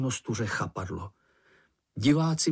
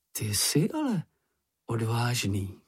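ordinary delivery

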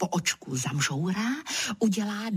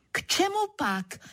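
much higher, exclaimed question; mild mock shout; 11 semitones higher than usual. A narrator's reading. Narrator shouting again at the leopard, mild shout. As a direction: pitch the question way up without more volume. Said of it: "rozkřiknu se zas".

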